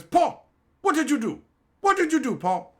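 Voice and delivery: high, loud accusing yell; mock angry accusation. Crackhead yelling at Paul over the coffee maker, high and loud.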